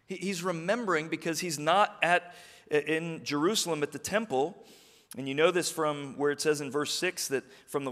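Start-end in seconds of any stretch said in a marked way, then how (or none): none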